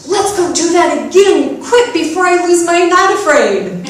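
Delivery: put-on high, eager child's voice; bright little kid urging a friend on; little girl voice. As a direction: chirp it like an excited three-year-old.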